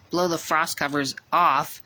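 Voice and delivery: ordinary delivery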